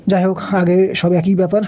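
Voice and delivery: ordinary talk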